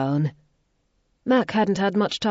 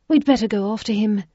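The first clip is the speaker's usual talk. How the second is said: 1 lady's voice; 6 semitones higher, 5 dB louder than usual. A narrator's reading. Isabella voiced.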